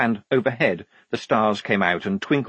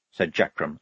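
plain reading voice

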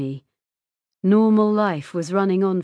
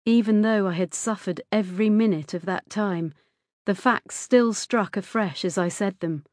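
usual speaking voice

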